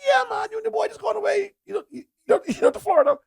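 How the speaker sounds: hammed-up high teasing; high mock shout. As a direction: squeal it like an overexcited buddy. Retold man's excited teasing.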